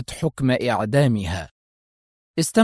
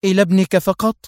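high, indignant question; furious hurt; 6 semitones higher, 4 dB louder than usual. A narrator's reading. Wife asks if only his son matters, resentful. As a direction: ask it stung and resentful. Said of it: "ارتجفت محنقة وهي تقول".

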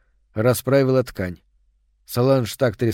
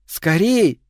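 call; 5 semitones higher than usual. A narrator's reading. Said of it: "крикнула девочка".

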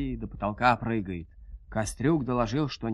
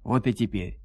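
usual speaking voice

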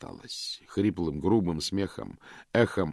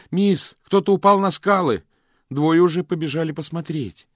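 alarmed shout; 10 semitones higher, 10 dB louder than usual. Acted.

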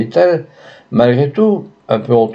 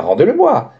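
a higher, urgent cry; pleading cry; 6 semitones higher than usual. A narrator's reading. Father cries to the courtesan for his son. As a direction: cry out to get the body back. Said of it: "s'écria Houzelot".

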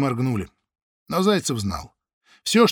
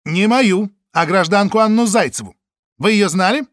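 stern questioning; 6 semitones higher, 6 dB louder than usual. Acted. Чекист допрашивает.

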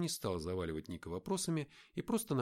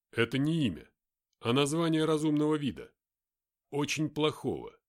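louder, higher blunt soldier; a hint of a gruff fighter; lightly voiced tough soldier; 5 semitones higher, 8 dB louder than usual. Mark explains.

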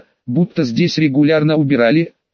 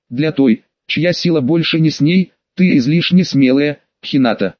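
ordinary delivery

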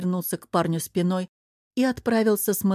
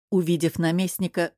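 ordinary delivery, nothing marked

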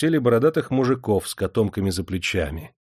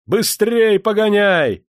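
much higher, louder, slow yell; slow, loud, urgent shout; bellowing order; 12 semitones higher, 8 dB louder, slower than usual. Acted. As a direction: roar at the driver to speed up. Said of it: "заорал Демидов".

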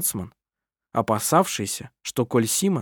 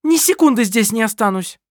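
furious exit; 9 semitones higher, 4 dB louder than usual. A narrator's reading. Kerstin storms out.

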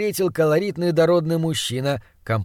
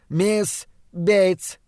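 put-on higher-pitched young girl cutting in; interrupting girl; 5 semitones higher than usual. A narrator's reading. Girl interrupts her uncle's introduction, assertively. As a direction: break in on the introduction with your name. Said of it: "прервала его девочка".

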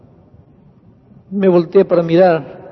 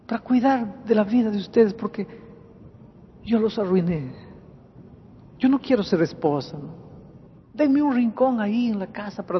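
tearful pleading; 7 semitones higher than usual. Retold or imitated. Repentant wife begging husband and children to let her care for them, tearful pleading. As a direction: beg for the least place, pitch rising with each refusal. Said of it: "llorando decía cosas como más o menos así".

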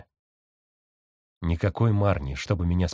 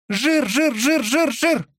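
very high, louder squeaky coo; cooing pigeon; 4 dB louder, much slower than usual. A narrator's reading. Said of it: "сказал голубь".

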